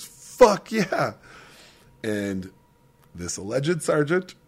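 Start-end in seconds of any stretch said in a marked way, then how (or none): 0.0-1.1 high, cocky mock exclamation; mock cocky reply. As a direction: throw it back high, imitating a defiant kid. Kid answers sergeant's challenge, retold.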